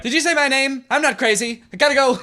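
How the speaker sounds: high, hurried mock voice; mimicking the bully. Player mocks the game bully, high and hurried. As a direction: parody her abrupt exit in a high voice. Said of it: "She was just like".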